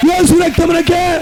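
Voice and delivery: slow, very high shout; shouted acclamation. Acted. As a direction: cry it out to the hall. (యేసు రక్తమునకే అని బిగ్గరగా అరవడం)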